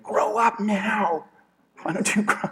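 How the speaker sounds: high, slow mock-stern dad ordering a baby; hammed-up impatient new father, drawn out; Will Ferrell bit. Comic dad commanding his newborn to grow, high and slow. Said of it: "he's got a new baby and he's like".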